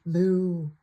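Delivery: loud, drawn-out mock moo; cow moo. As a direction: moo like a playful cow for a child. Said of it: "Does the cow say".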